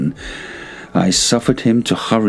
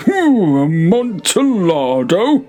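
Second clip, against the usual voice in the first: high, drawn-out delighted ooh; eager exclaim; 11 semitones higher, slower than usual. Acted. Fortunato to Montresor, craving the wine, high and slow. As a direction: savor the name like a wine lover.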